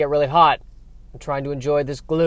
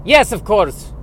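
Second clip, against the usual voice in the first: put-on high, loud outburst in vampire accent; exasperated vampire; 6 semitones higher, 8 dB louder, slower than usual. Vampire barista answering an obvious remark, exasperated. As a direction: snap back as if it were obvious.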